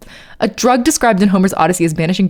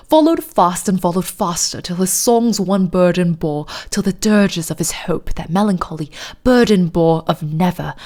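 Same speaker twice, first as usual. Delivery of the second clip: poem recited in put-on British; hammed-up British poetry reading; fake British accent; 2 semitones lower than usual. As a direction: read dramatic verse in a mock British accent. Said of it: "middle of a fake British accent".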